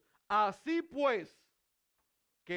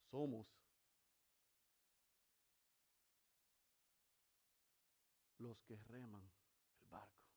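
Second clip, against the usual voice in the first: soft, grave sermon voice; hushed preaching; 7 semitones lower, 6 dB quieter than usual. Real, not acted. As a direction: drop to a near-hush to draw them in.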